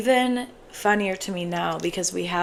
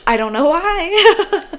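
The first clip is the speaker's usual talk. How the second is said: drawn-out loud why; loud exasperated; 10 semitones higher, 7 dB louder, much slower than usual. Real, not acted. Laughing exasperated outburst.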